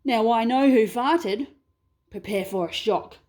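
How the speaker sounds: lightly voiced Australian bloke; a hint of an Aussie protest; Aussie dingo. Dingo denies it and blames the croc, lightly Aussie.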